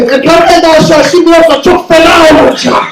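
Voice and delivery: loud, high Turkish threat; angry dad shouting. Retold or imitated. Dad threatening the son hiding upstairs, shouting. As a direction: threaten him in Turkish at full volume. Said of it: "So he says".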